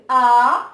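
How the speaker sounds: a held long and loud. Shown as a demonstration. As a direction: sustain the vowel a. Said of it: "la voyelle longue".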